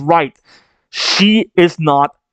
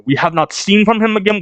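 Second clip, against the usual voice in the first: ordinary delivery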